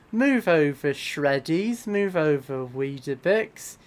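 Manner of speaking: playful fake British accent; mock British accent. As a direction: pitch a breakfast cereal in a jokey British accent. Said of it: "How's my British accent?".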